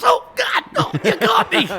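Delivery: high laughing mock squeal; caught-out friend.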